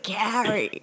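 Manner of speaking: put-on Carrie in a laughing New Jersey accent, K dropped; Jeff Lubin's New Jersey accent. Host mocking her teacher's New Jersey accent with a laugh. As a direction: tease a New Jersey mentor's mangled Carrie through a laugh. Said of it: "New Jersey-esque accent".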